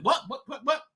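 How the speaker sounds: normal talking voice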